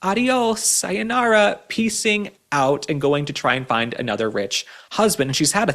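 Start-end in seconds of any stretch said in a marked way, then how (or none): 0.0-2.9 high, drawn-out mock farewell; sing-song sendoff, lightly voiced; mocking Hilaria. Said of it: "I could see her saying".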